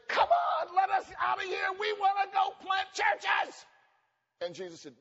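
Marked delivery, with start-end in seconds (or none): 0.1-3.6 very high, loud mock shout; impatient church. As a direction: beg to be let out. Church voiced begging to leave.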